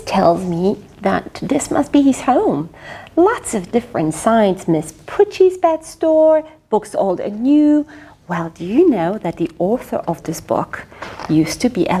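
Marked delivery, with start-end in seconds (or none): none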